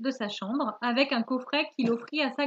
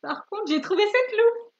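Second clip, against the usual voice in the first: high, loud little character voice; high-pitched storybook animal; child badger voice; 9 semitones higher, 5 dB louder than usual. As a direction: pipe up hopefully as the young badger. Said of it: "je fais la voix du".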